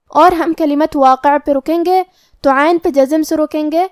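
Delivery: normal talking voice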